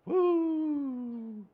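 high, drawn-out "woo"; long woo. Real, not acted. Preacher reacting to bad financial decisions, long high woo. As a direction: let out one long, falling whoop.

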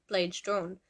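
usual speaking voice

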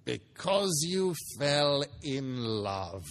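slow mocking growl. Scrooge mocking his nephew's reason, slow contemptuous growl. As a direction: drag it out with contempt. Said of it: "growled Scrooge".

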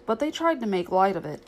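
plain reading voice

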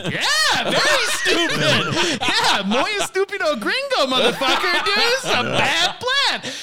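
high, laughing taunt, words stretched out between chuckles; laughing mockery. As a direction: laugh at the gringo while calling him stupid.

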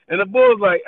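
ordinary talk, nothing marked

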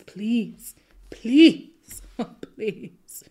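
begging, rising then whispered; pleading. Retold or imitated. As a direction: plead with them. Pleading with friends.

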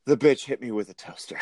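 a Bill Murray impression, dazed. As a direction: mumble it stunned like Bill Murray. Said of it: "In very, very Bill Murray voice".

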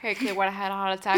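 fast Boston accent, R dropped. Shown as a demonstration. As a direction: clip it like a Bostonian. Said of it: "say it with a Boston accent".

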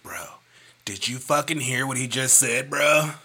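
loud bro-style impression of a friend; wrong Wesley voice. Friend mimicked the wrong way, as a loud bro. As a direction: ham up a bro guy. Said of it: "That's not how he talk".

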